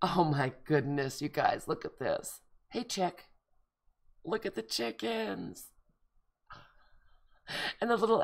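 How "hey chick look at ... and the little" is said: talking sweetly to little chicks; cooing at chicks. Real, not acted. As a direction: coo at the drawn chickens.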